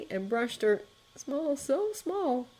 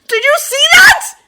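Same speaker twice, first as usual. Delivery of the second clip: much higher, louder excited cry; elated shout; 18 dB louder than usual. A narrator's reading. Jack waking free and certain, shouting in elation. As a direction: burst out with wild joy.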